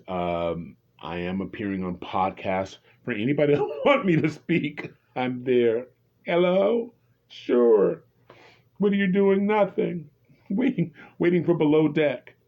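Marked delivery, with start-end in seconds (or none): 3.0-5.9 higher, amused, laughing through it. Real, not acted. Laughs while offering to appear. 6.2-12.3 high, loud mock phone pickup; playful eager answering. Acts out eager phone call, laughing.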